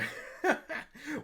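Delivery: sudden high-pitched burst of laughter; real squeaky laugh. Real, not acted. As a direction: crack up laughing.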